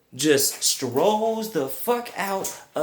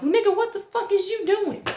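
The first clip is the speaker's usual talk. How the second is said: high, exasperated mock yell at a friend; incredulous outburst. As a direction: retell the outrage way up high. Yelling at friend who dropped tray.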